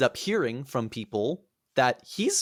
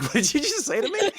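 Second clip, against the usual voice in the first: much higher, a bit louder, laughing indignation; offended mock Achilles; 13 semitones higher, 4 dB louder than usual. Achilles bristles at Iphigenia in a high, offended mock voice. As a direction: huff like a vain hero insulted. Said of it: "And he's like".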